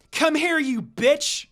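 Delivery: slow raging shout at the enemy; screaming. Acted. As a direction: yell it at her, dragging every word. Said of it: "Karin screamed".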